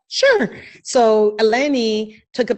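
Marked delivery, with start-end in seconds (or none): 0.1-0.6 high, breezy mock "Sure"; easygoing governor. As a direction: toss off an easy yes in a lifted voice. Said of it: "He's like".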